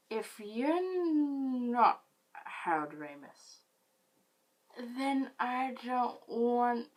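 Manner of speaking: put-on slow, slurred drunk reasoning with a whispered aside. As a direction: lecture him slowly like a drunk. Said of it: "in my drunk state".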